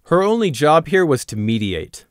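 ordinary delivery, nothing marked

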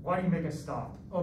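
normal talking voice

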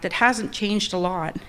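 ordinary delivery

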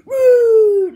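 high, very loud ghostly Woo!. Pretend. Plush trying a scare that comes out ghostly woo. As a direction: wail it like a cartoon ghost. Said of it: "that just sounds like, um, like I'm a ghost".

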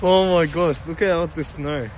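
excited gasp. Real, not acted. Excited at snow.

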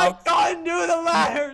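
high, loud whine; despairing wail. Real, not acted. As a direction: whine loudly that it's hopeless. Keeps failing the ladders.

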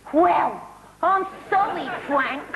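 brassy Cockney landlady; Peggy Mitchell impression. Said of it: "a key phrase with her".

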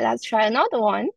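usual speaking voice

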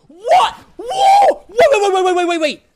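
high panicked shout. Real, not acted. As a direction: yell wait over and over. Sudden shock in game.

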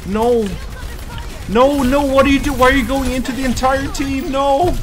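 high, loud frantic scolding. Real, not acted. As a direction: yell at teammate in a panic.

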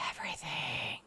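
soft voiceless whisper; whispered dismay. Real, not acted. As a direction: breathe the word out in dismay. Whispered disbelief.

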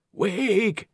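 high ghostly call; overlapping graveyard whispers. Acted. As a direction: spirits of the dead urging. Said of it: "the dead whispered".

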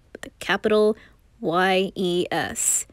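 teasing emphatic spelling of "Y E S"; spelled-out emphasis. Acted.

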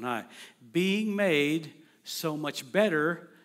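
reverent quoting of Hebrews; scripture recitation. Shown as a demonstration. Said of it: "I can even quote it".

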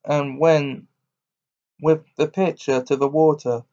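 ordinary delivery

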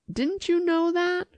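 high-pitched astonished question. A narrator's reading. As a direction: ask in disbelief, pitched right up. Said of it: "he said in astonishment".

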